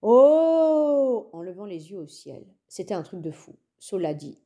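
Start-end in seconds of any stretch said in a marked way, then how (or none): 0.0-1.3 long, loud, high "oooh"; crowd's awed "oh". A narrator's reading. Everyone looks up at the stars, awed oooh. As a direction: rise and fall in one big wondering cry. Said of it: "tout le monde a fait".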